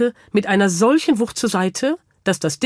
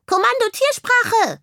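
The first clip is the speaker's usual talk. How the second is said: very high, squeaky insistent reminder; admonishing hamster. A narrator's reading. Hamster reminding the bird of the order, admonishing. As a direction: repeat the order pointedly, as a tiny commander. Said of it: "ermahnte ihn der Hamster".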